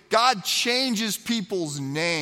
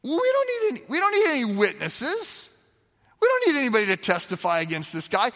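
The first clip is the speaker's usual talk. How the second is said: much higher, indignant priest voice; high-pitched mock indignation; outraged chief priests; 9 semitones higher than usual. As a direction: play the scandalized chief priests. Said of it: "the response of the chief priests is to say".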